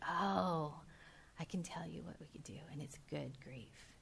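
soft, fast near-whisper; voicing Jesus in a soft hush. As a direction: lean in and hush it like a secret. Jesus voiced softly in retelling.

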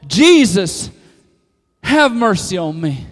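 loud, insistent, unstoppable shout; defiant crying out. Acted. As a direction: cry out over everyone trying to silence you. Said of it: "I'm crying out all the more".

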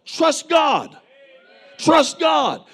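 high shouted exhortation. Acted. Preacher shouting to the congregation to trust God. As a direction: cry it out to the crowd.

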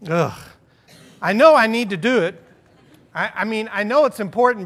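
groan, then burst out in grudging admission; exasperated protest. Acted. Pastor grumbling about lifting weights for his core, loud exasperation.

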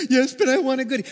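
loud, high mimicked child's whine; whining toddler. Toddler still begging the adult for a goodie, high imitated whine. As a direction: imitate a kid protesting tearfully. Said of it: "complaining and whining and crying".

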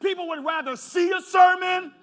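loud, rising pulpit cadence; preaching at full force. Acted. Pastor to congregation, preaching at full force. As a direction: drive the point home to the congregation.

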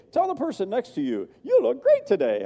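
mock-cheery host, pitched well up; high, sing-song mock announcer; chirpy speaker voice. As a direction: parody an upbeat stage speaker urging the crowd. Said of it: "somebody speaking, they say".

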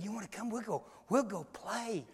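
put-on very soft invitation to a child; hushed coaxing. [name] luring a three-year-old out of church, hushed coaxing. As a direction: coax a toddler without disturbing anyone.

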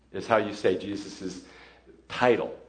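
normal talking voice

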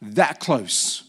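ordinary talk, nothing marked

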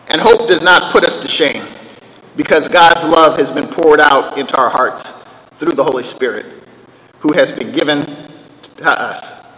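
scripture reading. Acted. Preacher to congregation, reading the verse solemnly.

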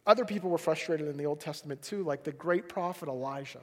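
usual speaking voice

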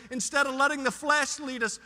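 normal talking voice

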